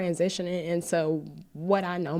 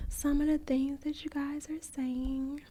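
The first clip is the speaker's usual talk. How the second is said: softer, near-whispered reading through tears; reading kind comments while crying; teary whisper; 3 semitones higher, 6 dB quieter than usual. Real, not acted. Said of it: "I'm tearing up".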